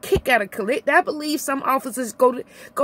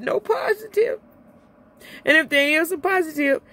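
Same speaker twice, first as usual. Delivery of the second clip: crying; 5 semitones higher than usual. Real, not acted. Rant breaks into tears.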